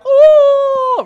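a very high, loud squealing ooh; mock cooing girls, very high; girls' ooh. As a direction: squeal like the girls crowding round. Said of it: "they, they are like".

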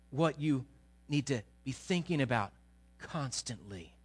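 normal talking voice

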